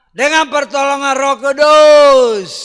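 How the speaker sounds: slow, loud and high preaching shout. Real, not acted. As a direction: cry it out at full force. Preaching climax. (seruan khotbah keras, lambat dan tinggi)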